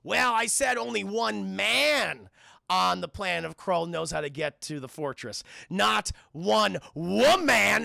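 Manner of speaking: slow, drawn-out emphasis on man and woman; pompous clarification. Retold or imitated. Old man explaining his loophole to companions, slow pompous clarification. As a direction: spell out the loophole grandly, stretching key words. Ynir pedantically corrects himself.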